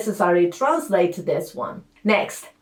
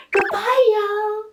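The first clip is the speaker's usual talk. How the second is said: playful goodbye; 10 semitones higher than usual. Pretend. Waving off the removed syllable.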